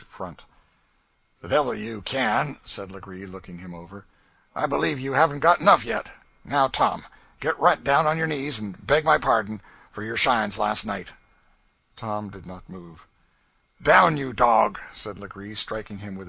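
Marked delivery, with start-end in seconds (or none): 1.4-2.6 sneering defiance. A narrator's reading. Legree confronting Tom. 4.5-11.1 fast, loud, higher threat; bully him into kneeling; loud menacing demand. A narrator's reading. Legree ordering Tom to beg. 13.8-14.8 much higher, loud shouted command; furious order. A narrator's reading. Legree whipping Tom.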